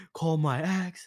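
imitated tearful drunk plea; mock bawling. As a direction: whine it as if starting to bawl. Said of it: "I started bawling".

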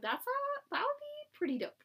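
high-pitched, tongue-in-cheek praise; mock enthusiasm. Host imitating her own reaction to co-host, mock-cool approval. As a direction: say it like you don't quite mean it. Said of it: "I don't think I've ever said dope with sincerity".

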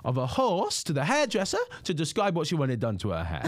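lightly voiced deadpan comedian; a hint of the comedian's dry delivery; mild imitation of a stand-up mid-joke; James Acaster. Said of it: "exactly what I would imagine James Acaster".

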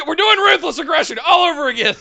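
very high, gleeful fan outburst; mock-hysterical excitement; hyped-up squeal. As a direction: squeal it like the whole thing is starting up. Hyped fantasy booking, breaking into laughter.

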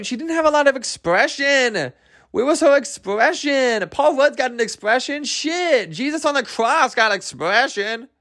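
high-pitched, hammed-up comic rant; exaggerated indignant riff; mock exasperation. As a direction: stretch "expression" in high, incredulous complaint. Comic rant about a stiff actress.

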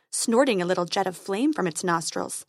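plain reading voice

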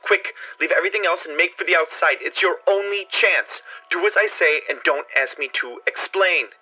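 high frightened urging; frantic warning. A narrator's reading. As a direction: beg him to flee before it's too late. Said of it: "in a pitch of wilder consternation".

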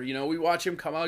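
ordinary talk

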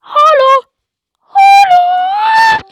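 high, loud sing-song call; hammed-up call across the playground; mock calling out. Creepy stranger calling out to the children, hammed-up shout.

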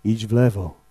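low, hushed divine command; God's voice. Acted. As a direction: speak it softly and deep, as a voice from above. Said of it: "to będzie Boży głos typu".

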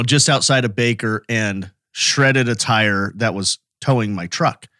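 ordinary talk, nothing marked

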